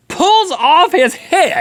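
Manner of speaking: high, drawn-out storyteller's shout; dramatic reveal. Real, not acted. Telling co-host what Frosty did next, dramatic shout. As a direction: hammer the shocking twist, pitch way up.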